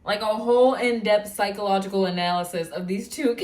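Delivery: ordinary delivery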